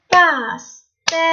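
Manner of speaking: pastèque slowly split as pas-tè-que; syllable by syllable. Shown as a demonstration. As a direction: tap out pastèque with a gap after each syllable. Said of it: "Tapez le mot".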